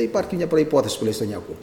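ordinary talk

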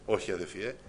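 normal talking voice

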